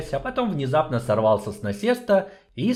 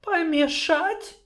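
high, slow, drawn-out groan; moaning; much slower than usual. Acted. Sorceress moaning in dismay at the dwarf. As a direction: groan the word in despair. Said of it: "простонала та".

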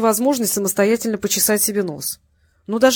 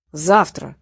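a hard, flat «Завтра» in a lower voice; 4 semitones lower, 4 dB louder than usual. A narrator's reading. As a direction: lay down «Завтра» as a final order. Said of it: "жёстко произнёс первый". (жёстко, низким голосом: «Завтра»)

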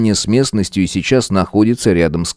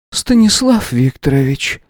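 weary; 6 semitones higher than usual. A narrator's reading. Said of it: "устало произнесла женщина".

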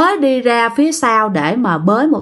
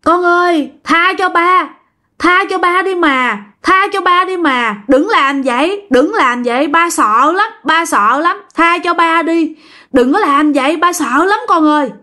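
frightened pleading cry; 6 semitones higher than usual. Retold or imitated. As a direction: plead for mercy in a high, repeating wail. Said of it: "nghe tiếng ông Phú la quá trời".

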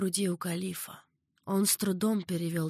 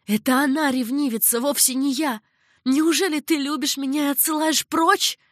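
higher and louder, indignant; angry outburst; 7 semitones higher, 7 dB louder than usual. A narrator's reading. Zeinab angrily accuses the caliph, high and loud. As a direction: flare up in jealous anger. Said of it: "Зейнаб вдруг озлилась".

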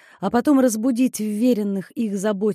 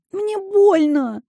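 slow, high, pained moan; 10 semitones higher, 4 dB louder, much slower than usual. Acted. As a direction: drag out the words, hurting. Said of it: "простонала Дейзи".

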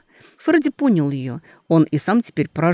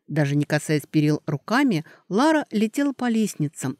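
ordinary delivery, nothing marked

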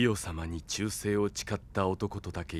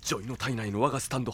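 ordinary talk, nothing marked

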